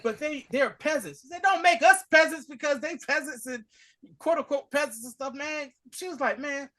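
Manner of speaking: high, coaxing woman's voice; Bella voiced. Bella comforting sulking Jace in a high voice. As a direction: lift him up like a pep talk.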